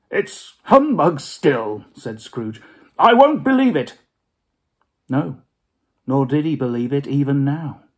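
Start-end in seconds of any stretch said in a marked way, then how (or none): 0.1-1.9 put-on slow, loud scoffing old miser; gruff Scrooge insisting it's humbug; stubborn old Scrooge. 3.0-4.0 much higher, much louder defiant miser; defiant Scrooge. Acted. Scrooge refusing.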